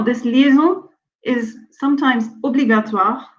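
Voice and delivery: ordinary talk, nothing marked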